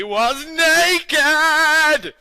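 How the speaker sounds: very slow, high, loud "naked!"; mock-scandalised outburst; shocked exclamation. A narrator's reading. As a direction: draw it out in scandalised horror. Narration hits a shocking detail.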